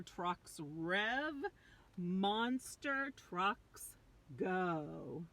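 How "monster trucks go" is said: plain reading voice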